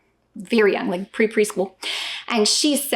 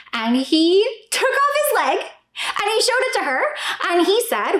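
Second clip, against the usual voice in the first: ordinary talk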